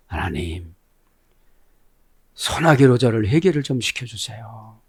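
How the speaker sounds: low, soft imitated plea; mock prayer. Preacher voicing a believer praying to God, softly pleading. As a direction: act out a subdued little prayer.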